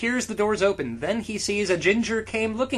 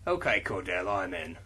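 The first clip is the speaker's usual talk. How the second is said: deep Harry; 10 semitones lower than usual. Acted. Harry voiced very low.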